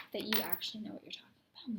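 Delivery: whispering. Real, not acted. Whispered aside.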